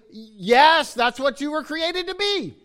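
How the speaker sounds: emphatic, high and loud yes. Acted. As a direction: proclaim it emphatically, voice soaring high. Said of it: "is emphatically".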